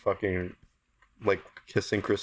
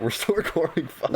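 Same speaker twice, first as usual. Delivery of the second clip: higher, laughing in embarrassment; 7 semitones higher than usual. Real, not acted. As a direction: break into laughter mid-sentence. Realizes still recording, laughing.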